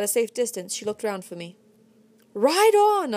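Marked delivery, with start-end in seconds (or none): 2.3-3.1 loud, high bawl. A narrator's reading. Captured narrator bawling at Kit to flee. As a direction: bellow it with all your lungs. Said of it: "I bawled".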